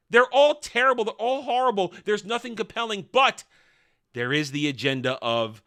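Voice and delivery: normal talking voice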